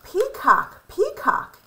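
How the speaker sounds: high, bright call. A narrator's reading. Lolly calls Peacock, rising high. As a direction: call out to Peacock across the yard.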